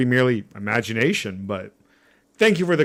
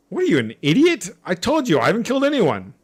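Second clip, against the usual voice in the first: higher, sharp exasperated retort; irritated denial; 8 semitones higher than usual. Acted. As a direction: snap back at the accusation. Suspect angrily denies killing.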